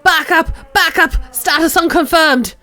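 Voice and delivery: high, loud screamed orders through a megaphone. Acted. Deranged woman screams into her megaphone, high and loud. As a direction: shriek it like a frantic cop at a crowd. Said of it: "raises it again and screams".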